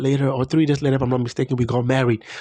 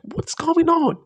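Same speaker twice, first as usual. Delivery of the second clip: high, overjoyed cry of disbelief; joyful disbelief; slower than usual. Retold or imitated. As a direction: burst out in giddy amazement, voice shooting up. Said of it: "I'm like".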